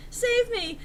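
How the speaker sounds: high, loud desperate plea; cry for help. Acted. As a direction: scream to be rescued. Character cries for help.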